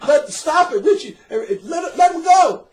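loud, high mock shout to let go; retold shout. Priest breaking up the fight, loud high shout.

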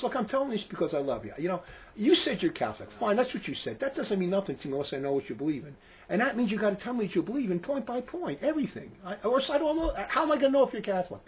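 soft, calm and kind; gently, like you care for them; saying it nicely. Shown as a demonstration. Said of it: "you can say it nicely".

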